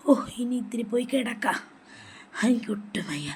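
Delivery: breathy, near-whispered weary complaint; weak and weary. Acted. Ailing grandmother going to lie down, weak and weary. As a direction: let the voice give out, soft and drained.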